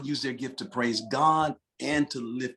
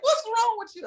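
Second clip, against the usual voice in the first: mock puzzled wife. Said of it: "she would look at me like".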